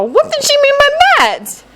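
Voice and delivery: put-on high, puzzled boy voice; wondering inner thought.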